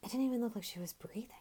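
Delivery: fast tense whisper; hushed whisper. Acted. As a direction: whisper it like a secret by candlelight. Tense ghost story, hushed.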